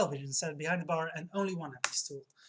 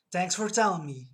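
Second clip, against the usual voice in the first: sarcastic; 7 dB louder than usual. Acted. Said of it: "he responds sarcastically".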